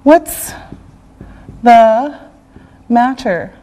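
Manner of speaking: normal talking voice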